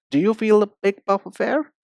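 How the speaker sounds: usual speaking voice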